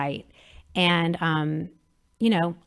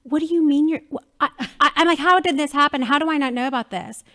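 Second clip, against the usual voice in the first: higher, louder exasperated scolding; a hint of a scolding friend; mock scolding; 6 semitones higher, 5 dB louder than usual. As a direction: lay into a friend, half joking. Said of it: "I just laid into her".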